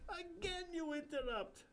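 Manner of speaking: put-on high, slow Indian accent rebuke; slow scolding Indian guru. Bapucharya scolding the interrupting narrator, Indian accent. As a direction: chide the interruption slowly.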